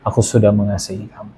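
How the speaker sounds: low, softened retold words of comfort; gentle, peaceful voice. Holy Spirit reassuring a crying believer, low and gently. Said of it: "suaranya lembut dan ada damai sejahtera".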